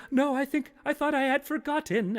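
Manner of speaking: very high, hammed-up protest; haunted man. Steve answers his haunting pants, high haunted-man voice. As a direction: answer the phantoms in a high stage voice. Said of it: "Steve goes".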